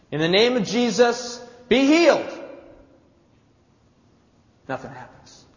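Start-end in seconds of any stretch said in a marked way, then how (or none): 0.1-2.4 put-on loud, high proclamation at the sick boy; a disciple shouting the healing command, loud; commanding healing cry. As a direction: order the demon out with full force.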